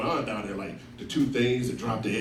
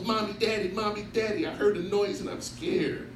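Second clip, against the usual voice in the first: put-on scared little girl; 8 semitones higher, 3 dB quieter than usual. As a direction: whimper it like a scared little daughter. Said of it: "And she goes".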